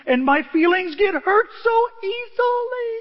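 high, drawn-out hammed-up whine; wimpy mock wail. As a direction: pretend to blubber like a wimp. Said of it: "I'm a wimp".

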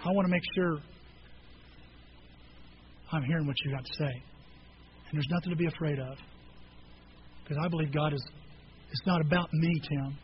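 low, soft, gentle listener; quietly reassuring, pitch dropped; calm reassurance. Retold or imitated. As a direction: act out a patient friend soothing someone anxious. Voices elder calmly reassuring him.

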